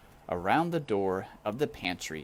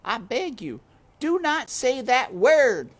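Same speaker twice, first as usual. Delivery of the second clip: high, loud scornful housekeeper; grim maid Louise; 8 dB louder than usual. A narrator's reading. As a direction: snap it like a tough housekeeper. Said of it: "said Louise".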